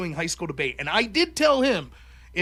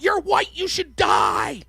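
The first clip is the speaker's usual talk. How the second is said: high, loud, slow mock yell; mock shouted accusation; 6 semitones higher, 6 dB louder, slower than usual. Novice debater yells at the other team, mock shout. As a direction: blurt it out like a hostile novice.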